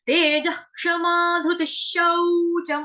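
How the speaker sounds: slow sacred chant of the verses; Sanskrit recitation. Shown as a demonstration. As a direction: intone each verse slowly.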